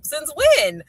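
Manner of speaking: high, punchy questioning; incredulous challenge. Retold or imitated. Mother challenging her son's claim, incredulous challenge. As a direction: demand proof in mock disbelief.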